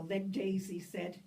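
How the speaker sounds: ordinary delivery, nothing marked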